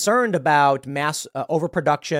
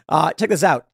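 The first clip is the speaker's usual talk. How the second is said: ordinary talk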